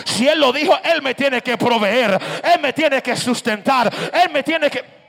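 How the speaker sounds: loud, driving preacher's shout; full-force preaching. Acted. Preacher declaring his trust in God's provision, full-force preaching. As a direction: fire out each declaration at full volume.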